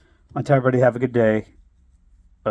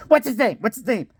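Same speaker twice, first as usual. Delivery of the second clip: eager repeated question; 6 semitones higher than usual. Retold or imitated. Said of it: "My ex-father-in-law's like".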